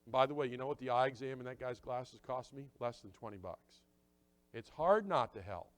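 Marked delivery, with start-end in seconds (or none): none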